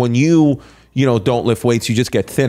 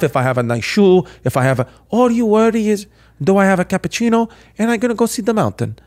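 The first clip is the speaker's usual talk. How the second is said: higher Italian accent impression; 5 semitones higher than usual. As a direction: retell it in a thick Italian accent. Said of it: "Can you do it in an Italian accent?".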